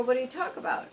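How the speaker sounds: high-pitched, interested question; retold keen curiosity. Bartender asking a customer about podcast topics, keenly curious. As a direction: lift the pitch, eagerly curious.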